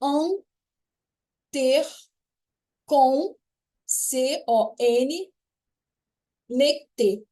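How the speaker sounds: En, ter, con spaced out with long pauses; syllable by syllable. Shown as a demonstration. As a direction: spell the syllables out slowly, one by one. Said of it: "a separação ficaria assim, ó". (sílaba por sílaba, pausado e lento)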